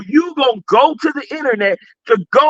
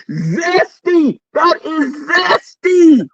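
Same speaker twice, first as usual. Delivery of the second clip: mocking drawl; 5 semitones higher, 3 dB louder, much slower than usual. Real, not acted. Mocking judgement, drawn out.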